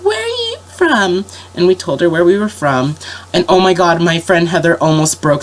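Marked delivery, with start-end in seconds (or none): 0.0-1.2 high, slow sweet question; much higher, slow princess sweetness. Retold or imitated. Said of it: "she's like".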